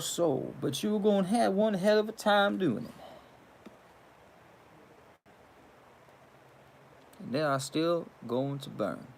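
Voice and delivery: put-on old-school Southern devil, unhurried; Southern Carolinas accent. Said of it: "old school Southern Carolinas accent I had".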